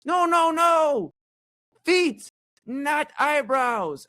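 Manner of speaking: high, loud, slow cry of alarm; panicked salon lady. Retold or imitated. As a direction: imitate the lady shouting a warning across the room. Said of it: "She goes".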